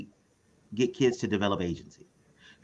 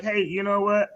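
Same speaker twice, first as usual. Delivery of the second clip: higher, earnest parent asking for help; worried parent voice; 9 semitones higher than usual. Retold or imitated. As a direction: play a struggling parent. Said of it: "the people who are like".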